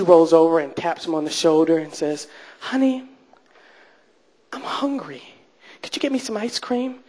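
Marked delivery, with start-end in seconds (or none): 2.6-7.0 soft, high, coaxing imitation; wheedling woman. Woman waking her man at three A M, mock wheedling. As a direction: coax sweetly in a small high voice.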